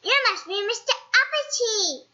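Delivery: high, knowing goblin voice; a sly goblin guess. Acted. Goblin recognising Mister Uppity, high and sly. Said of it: "said the goblin".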